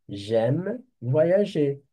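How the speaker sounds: J'aime voyager said slowly and clearly for students to copy; slow dictation. Shown as a demonstration. Teacher to French students, slow and clear dictation. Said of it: "teacher repeats phrase slowly".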